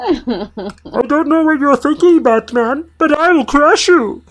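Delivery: high, slow hammed-up Bane; menacing villain, played for laughs. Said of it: "he does sound like Marvin the Martian".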